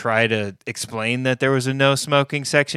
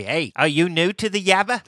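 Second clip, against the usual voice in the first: mock local tough; 7 semitones higher than usual. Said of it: "Does he say".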